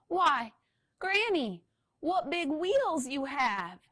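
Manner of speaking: high, slow wide-eyed question; wondering little scooter. Acted. Little Red asks in wonder.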